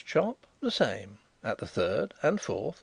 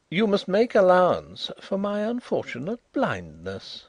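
grim irony; 8 semitones higher, 3 dB louder than usual. A narrator's reading. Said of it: "apologized Carrados, with grim irony".

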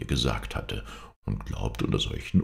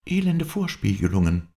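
high, louder outburst; indignant exclamation, pitched up; indignant cry; 9 semitones higher, 5 dB louder than usual. A narrator's reading. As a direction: cry out against a deception. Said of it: "rief sie".

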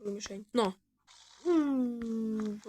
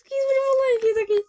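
high, loud, fast cooing; 13 semitones higher, 9 dB louder, faster than usual. Real, not acted. Player gushes at a tiny creature, high and squealing. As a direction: squeal over something tiny.

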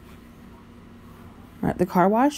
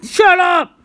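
loud shout; 9 semitones higher, 6 dB louder than usual. Real, not acted. Playful outburst at camera.

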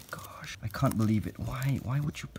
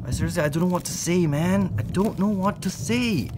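higher, fast, flustered; a touch higher, rushed shock over the dead spider; stunned disbelief; 9 semitones higher, 3 dB louder than usual. Real, not acted. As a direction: blurt it out, lost for words. Shocked at another damaged spider.

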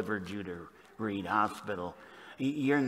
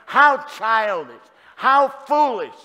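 mock scorn; 12 semitones higher, 13 dB louder, slower than usual. Mocking onlookers' scornful remark.

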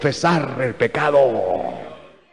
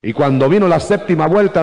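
ordinary delivery

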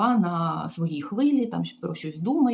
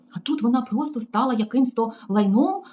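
usual speaking voice

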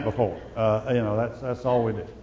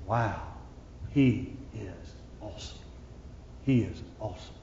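much softer, lower, breathing out the wonder; hushed awe; 7 semitones lower, 14 dB quieter than usual. Pretend. Worshipper realizing God's greatness in church, hushed awe. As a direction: murmur it as if struck by God's greatness. Voiced awe before God.

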